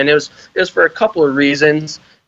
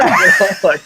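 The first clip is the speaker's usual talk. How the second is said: normal talking voice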